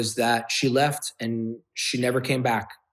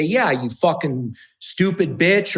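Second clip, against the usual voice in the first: raised, louder mock yell; retold fighting insult; 7 semitones higher, 4 dB louder than usual. Re-enacting name-calling at his girlfriend in fights, heated. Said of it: "when we were fighting".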